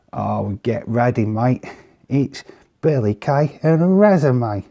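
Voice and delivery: loud, hammed-up bad Australian accent; terrible Australian accent. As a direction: do a badly faked Australian accent, rising high at the end. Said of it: "that is a terrible Australian accent".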